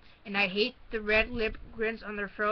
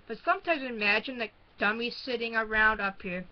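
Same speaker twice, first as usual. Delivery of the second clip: plain reading voice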